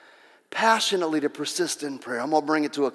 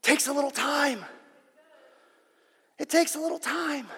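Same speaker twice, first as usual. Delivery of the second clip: ordinary delivery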